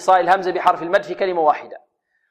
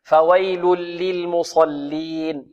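slow "فويل للمصلين", each lam given its time; unhurried recitation; much slower than usual. Shown as a demonstration. Teacher shows the careful reading, slow measured "فويل للمصلين". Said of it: "يحذر القارئ من خطفها والإسراع فيها".

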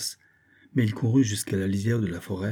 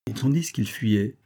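plain reading voice

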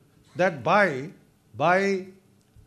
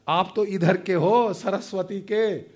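usual speaking voice

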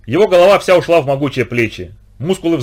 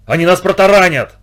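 high, alarmed shout; yelling; 5 semitones higher, 4 dB louder than usual. A narrator's reading. Patch warning Mike of the oncoming boat, yelling. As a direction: yell a warning of disaster. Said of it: "Патч заорал".

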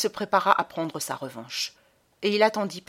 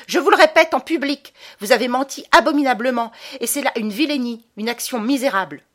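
very loud, high, fast public shout; shouting at full voice; 6 semitones higher, 8 dB louder than usual. A narrator's reading. Boy shouting at the baron before everyone, full-voice yell. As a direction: yell it so the whole hall hears. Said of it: "crier soudain de sa voix la plus forte".

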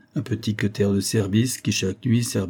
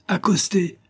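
shouted order; 10 semitones higher, slower than usual. A narrator's reading. Elim orders sailors to come alongside, calling out. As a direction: call the order out high. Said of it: "criait Elim aux matelots".

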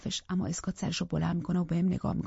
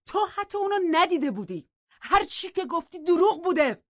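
much higher, shouted accusation, slowing at the end; shouting; 10 semitones higher, 5 dB louder than usual. Acted. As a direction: yell that she lied. (داد زدن با صدای بلند و زیر)

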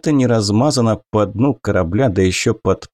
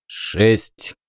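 curt; 3 dB quieter than usual. Acted. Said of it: "отрезал Фритьёв".

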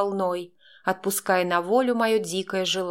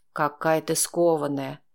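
low, velvety murmuring man; velvety male murmur; 4 semitones lower than usual. A narrator's reading. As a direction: purr it in a lower velvet voice. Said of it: "прошептал он бархатным голосом".

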